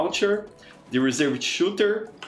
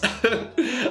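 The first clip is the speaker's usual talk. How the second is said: quick amused laugh; a bright burst of laughter; short laugh; 10 semitones higher than usual. Real, not acted. Laughing at chat's joke.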